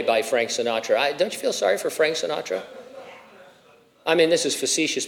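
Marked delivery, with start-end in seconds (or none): none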